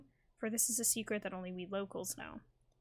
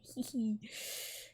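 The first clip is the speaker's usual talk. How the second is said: short embarrassed giggle; 3 dB quieter than usual. Real, not acted. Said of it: "afternoon coffee time".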